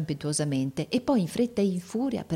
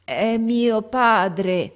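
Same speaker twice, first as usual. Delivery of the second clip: very slow, loud, high and carefully pronounced; strange, tuneless voice; 6 semitones higher, 6 dB louder, much slower than usual. A narrator's reading. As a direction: deaf girl sounding out each word in an odd voice. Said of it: "con una voce grossa, strana, stuonata".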